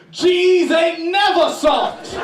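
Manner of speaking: slow, high, laughing yell; mock shout. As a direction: bellow it as the final word. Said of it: "And then Marquise Johnson goes".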